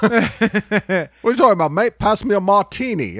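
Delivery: mock posh Crocodile Dundee asking for a martini. Friends mock the changed hero with a light English accent. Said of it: "he doesn't talk in the accent anymore".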